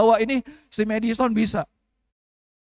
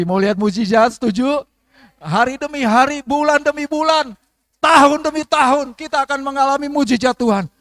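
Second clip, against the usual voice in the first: builds louder and higher to a ringing peak; rising preaching climax; 5 semitones higher, 7 dB louder than usual. Acted. Pastor building the congregation up in rising loud preaching. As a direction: climb to the climax line by line.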